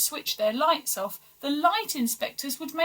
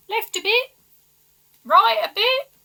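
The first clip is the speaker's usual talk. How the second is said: high, loud workman call; calling directions; 11 semitones higher, 8 dB louder than usual. Acted. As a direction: shout guidance to the crane. Workers positioning the artificial sun.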